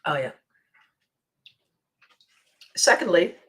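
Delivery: ordinary talk, nothing marked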